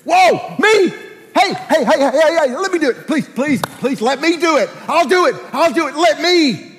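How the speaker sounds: high, urgent begging to be picked; frantic, high-pitched volunteering; eager pleading volunteer. Shown as a demonstration. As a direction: beg excitedly for the job. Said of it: "it means this".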